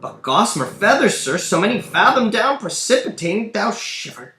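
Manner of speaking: high, loud put-on voice; exaggerated high stage voice; another character's voice. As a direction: declaim in amazement, pitched well up. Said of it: "It's another voice speaking".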